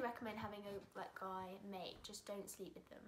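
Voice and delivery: hushed whisper. Real, not acted. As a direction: say it under your breath. Whispered aside about guy mates.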